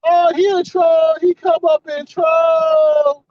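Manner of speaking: high, slow mocking singsong; mocking the panel. Commentator mocks the panelists excusing the guest, high and slow. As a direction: drag it out high like silly hangers-on. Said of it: "they were trying to validate, like".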